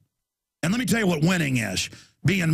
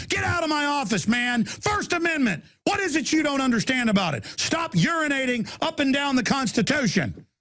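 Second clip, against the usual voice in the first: retold angry order; 7 semitones higher than usual. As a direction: bark it like throwing them out. Ordering agents out of his office.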